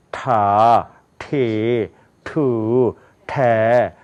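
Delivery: drawn-out 타, 차, 라, 파 rows in English sounds; English consonant drill. Shown as a demonstration. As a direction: chant each syllable from 타 to 포 separately, long and evenly. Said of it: "이건 치경입니다".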